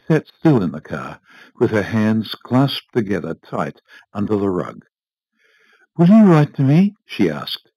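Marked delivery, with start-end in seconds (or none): 6.0-6.9 high, raised hopeful question; hopeful asking. A narrator's reading. Wife asking her husband for letters, hopeful. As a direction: ask with an anxious lift in pitch.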